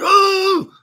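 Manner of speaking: put-on a high, frustrated child's "Ooh!"; fed up with the rhyming cat; angry kid groan. Kid complaining to his mom about a cartoon, high frustrated "Ooh!". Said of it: "I was like".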